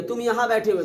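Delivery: usual speaking voice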